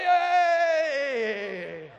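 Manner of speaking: loud, high whoop of victory; triumphant cheer. Retold or imitated. Retelling her lucky guess, a loud high cheer. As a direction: cheer it out like you just won. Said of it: "And I was like".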